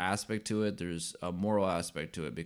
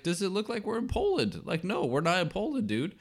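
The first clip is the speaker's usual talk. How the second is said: incredulous, almost squeaky "are you serious"; exasperated disbelief; 12 semitones higher than usual. Retold or imitated. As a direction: pitch jumps way up in mock disbelief. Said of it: "I'm like".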